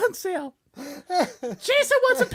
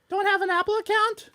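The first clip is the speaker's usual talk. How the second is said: ordinary talk, nothing marked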